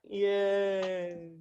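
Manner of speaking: half-hearted, drawn-out mock cheer; unenthusiastic yay. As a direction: imitate siblings who barely care. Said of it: "They're like, "Yay." more enthusiasm than my own bloody siblings".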